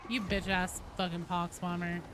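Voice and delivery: ordinary talk, nothing marked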